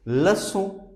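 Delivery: "leçon" with ç as an S, "luh-son"; cedilla as S. Shown as a demonstration. Teacher gives French for lesson, "leçon" with S sound. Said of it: "its pronunciation is S".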